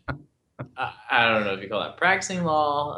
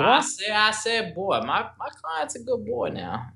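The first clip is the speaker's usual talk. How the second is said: Southern lawyer; 10 semitones higher than usual. Pretend. Joking host defends his client in court, hammed-up Southern accent. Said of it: "say it in a Southern voice".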